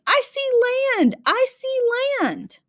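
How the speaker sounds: hammed-up shout of a sailor spotting land; mock scream. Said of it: "he's screaming".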